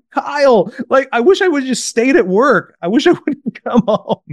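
mock a defeated dad venting his regret; acted dad's lament. Frustrated dad complains to coach.

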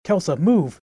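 urgent battle shout. Acted. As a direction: yell the warning across the fight.

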